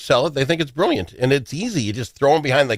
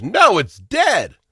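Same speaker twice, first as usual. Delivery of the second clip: drawn-out, higher put-on voice of the wife; slow high mock reassurance; 8 semitones higher, much slower than usual. Husband mimicking his wife's report about the mouse, slow and high. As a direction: stretch it out, lightly mimicking her calm. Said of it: "And he goes".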